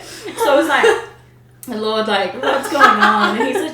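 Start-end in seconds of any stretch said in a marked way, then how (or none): 1.7-3.4 mock despairing prayer. Voicing her own despairing prayer.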